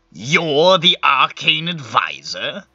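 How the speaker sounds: slow, drawn-out disdain; scornful predecessor. Acted. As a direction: draw out each word in disbelief at your successor. Infernal scorns his replacement.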